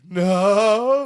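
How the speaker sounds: drawn-out imitation wail; mock sobbing. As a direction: lightly imitate a tearful refusal. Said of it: "he's crying and sobbing".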